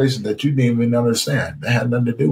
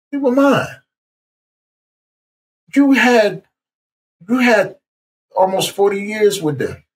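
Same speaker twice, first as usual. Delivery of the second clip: voice of God; 9 semitones higher than usual. Retold or imitated. God telling him his loved ones were His, high and commanding. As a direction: claim them back, lifted and firm. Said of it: "And the voice said".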